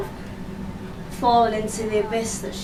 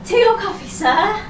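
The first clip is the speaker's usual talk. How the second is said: high, put-on attendant's offer; trolley-service voice, pitched up; cabin crew service voice; 9 semitones higher, 4 dB louder than usual. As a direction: offer drinks in a raised service voice.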